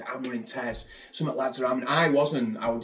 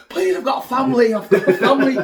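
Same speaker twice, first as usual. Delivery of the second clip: high, loud, laughing plea for his life; panicked begging; 11 semitones higher, 10 dB louder than usual. Retold or imitated. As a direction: beg the driver, half laughing, half terrified. Terrified passenger begging reckless driver.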